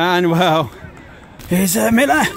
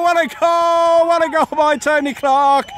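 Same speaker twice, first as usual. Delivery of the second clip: long goal yell; 3 semitones higher than usual. Real, not acted. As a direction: stretch out the goal call, thrilled. Goal celebration commentary.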